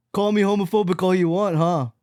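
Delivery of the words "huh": usual speaking voice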